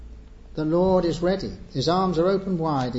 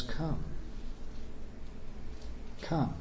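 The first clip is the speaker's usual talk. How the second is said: much softer, two hushed invitations; gentle call; 12 dB quieter than usual. Acted. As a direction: let each come fall softly, almost pleading. Voicing Jesus' gentle call.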